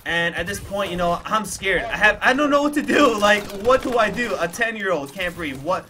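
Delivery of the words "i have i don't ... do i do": higher, louder, frantic burst; acted panic. Storyteller as his scared ten-year-old self, acted panic. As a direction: relive the panic with raised pitch and volume.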